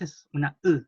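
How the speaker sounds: "u" said unrounded, lips spread; unrounded Mapuche "u"; sixth vowel ü. Shown as a demonstration. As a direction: say "u" with an i mouth. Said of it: "tienen que poner la boca como una".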